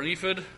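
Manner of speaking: "ephod" with a reduced fid at the end. Shown as a demonstration. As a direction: say "ephod" as EE-fid, long ee, weak ending. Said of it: "I'm not sure how to say it".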